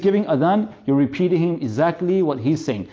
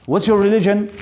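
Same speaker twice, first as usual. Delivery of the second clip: louder, higher stern question; questioning angel; 6 semitones higher, 5 dB louder than usual. Retold or imitated. Angel questioning the dead in the grave, loud and stern. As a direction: demand it like an interrogator. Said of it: "the three questions Munkar and Nakir are gonna ask".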